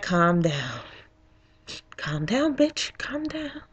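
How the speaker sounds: very soft muttered self-talk; self-soothing. Retold or imitated. Angry voter talking herself down, muttering. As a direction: keep it way under your breath.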